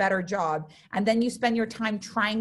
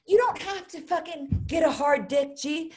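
fired-up yell; 6 semitones higher than usual. Real, not acted. Rising rant to the audience.

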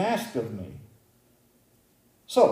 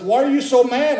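high, loud, quick demand; upset caller; 10 semitones higher, 6 dB louder than usual. Retold or imitated. Person calling about an email, acted-out upset demand. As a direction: imitate a worked-up caller demanding an explanation.

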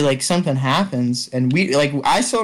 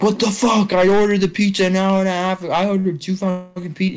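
higher-pitched imitation of an irate customer; retold angry outburst; 5 semitones higher than usual. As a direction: act out the dad laying into the delivery kid. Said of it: "he starts going in on this kid".

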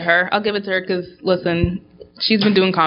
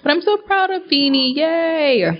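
high bright cheer for a friend's win; excited cheer; 10 semitones higher, slower than usual. Real, not acted. Cheering proudly for Beanie Feldstein's nomination, excited. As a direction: squeal it out with pride.

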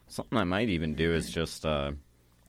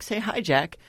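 put-on high, coaxing child voice; little girl voice; 14 semitones higher, 5 dB louder than usual. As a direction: nudge an invisible friend sweetly. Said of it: "she's like".

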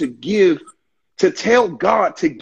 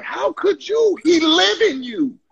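high, slow disbelief; disbelieving reproach; 11 semitones higher than usual. Retold or imitated. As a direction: ask it as if betrayed. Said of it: "I'd be like".

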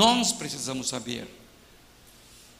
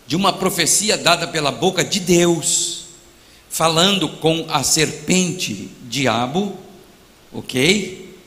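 loud pulpit preaching; fervent preaching; 7 dB louder than usual. Acted. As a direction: preach it out to the whole church. Preaching at full force.

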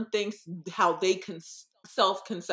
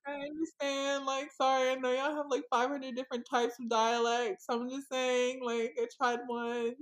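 higher-pitched defensive plea; mock apologetic; 6 semitones higher than usual. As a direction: make a playful excuse to offended viewers. Acts apologising to offended British viewers.

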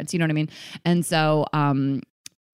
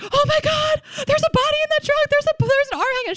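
very high, rapid hysterical retelling; mock dramatic panic. Prankster pretending to find a body, dramatic panic. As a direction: imitate an overdramatic freak-out, pitched way up. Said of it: "she's so dramatic".

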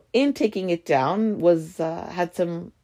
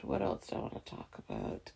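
much softer and lower, half to herself; muttered aside; 10 semitones lower, 17 dB quieter, faster than usual. Real, not acted. Musing to herself about the next topic, muttered quietly. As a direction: mumble it under your breath.

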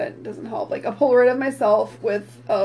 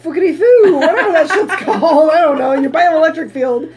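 laughing; 5 semitones higher, 8 dB louder than usual. Real, not acted. Laughing at own made-up word.

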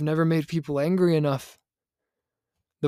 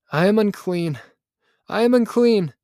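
raised, louder cry of self-reproach; anguished confession; 6 semitones higher, 6 dB louder than usual. A narrator's reading. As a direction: cry it out as a confession, building on the repeat. Anguished confession after falling among thorns.

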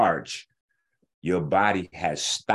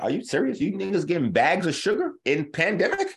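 much higher, incredulous rant; retelling his reply with outraged, pitched-up disbelief; exasperated disbelief; 9 semitones higher than usual. Retold or imitated. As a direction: shoot it up high like you can't believe they asked. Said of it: "I'm like".

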